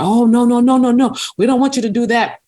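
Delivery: high, quick no no no; mock flustered school staff protest; hurried protest. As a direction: wave off the idea, flustered. Staff objecting, retold quote.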